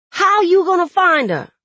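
loud, high-pitched agitated question; heated challenge; upset challenge. A narrator's reading. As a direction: demand an answer, voice jumping up. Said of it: "never seen him so upset before".

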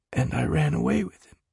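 soft, small, fading confession; very faint. A narrator's reading. Jude nearly cries telling his friend, very faint. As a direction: barely get the words out. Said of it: "his voice so faint now".

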